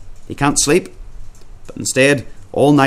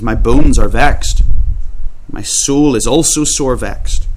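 slow, weighty psalm reading; slow solemn reading; 3 dB louder, slower than usual. Acted. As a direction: let each word of the verse land slowly.

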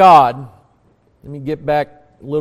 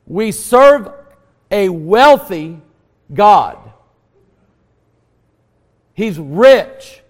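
raised, higher-pitched preacher's voice with punched key words; emphatic preaching; 5 semitones higher, 6 dB louder than usual. Real, not acted. Preacher telling the congregation of God's abundance, emphatic preaching. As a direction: preach it out with force.

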